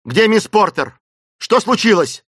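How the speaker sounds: loud, high demand; urgent questioning. A narrator's reading. Clayton questioning revived Esmeralda about missing Miss Porter, urgently. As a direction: fire out urgent questions at full voice. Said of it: "спросил Клейтон".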